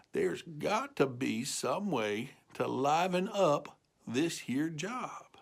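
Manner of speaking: soft, slow musing; slow soft inner thought; thinking aloud. Acted. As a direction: muse it quietly to yourself. Cow thinks to herself.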